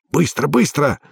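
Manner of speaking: tense, hissing command; hissed urgency. A narrator's reading. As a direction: hiss at them to hurry. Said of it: "прошипел голос".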